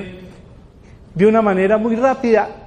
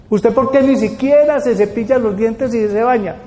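pressing questioner; 4 semitones higher than usual. Retold or imitated. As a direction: demand an answer like a worried parent. Parent questions a depressed person.

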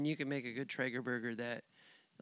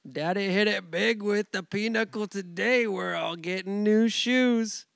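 high, loud hammed-up boast; slow mock family brag; mock bragging dad; 7 semitones higher, 7 dB louder than usual. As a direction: brag like daddy just won big.

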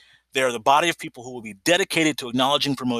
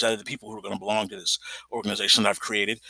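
usual speaking voice

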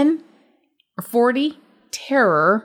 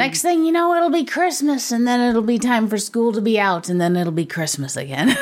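put-on old lady voice. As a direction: play a fussy old lady from old radio. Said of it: "What voice is that?".

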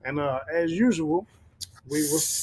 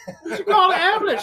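high, laughing mock yell; laughing shout; 11 semitones higher than usual. Friend laughing and yelling a joke line at the hosts.

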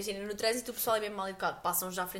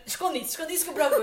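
put-on childish eager plea; 5 semitones higher, 5 dB louder than usual. Acting childish plea to mom.

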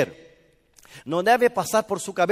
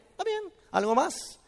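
easygoing Samson; 10 semitones higher, 4 dB quieter than usual. Retold or imitated. Sansón lifting a piano like a toy.

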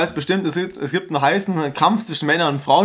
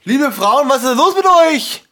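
mock scolding; 10 semitones higher, 5 dB louder, slower than usual. Mock scolding the women listeners.

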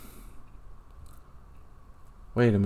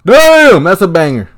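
a sudden, loud, high shout of awe; amazed shout; 7 semitones higher, 9 dB louder, slower than usual. Real, not acted. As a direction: blurt it out at the top of your voice. Reacting to a replayed goal.

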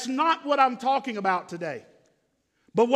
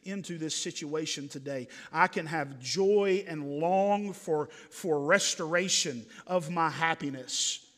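gentle; 6 semitones lower, 4 dB quieter than usual. Real, not acted. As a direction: ease into it gently, like comforting the bereaved. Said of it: "I wanna be very gentle this morning".

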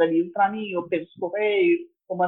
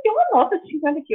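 retold surprise; 6 semitones higher, faster than usual. Finding money in the book.

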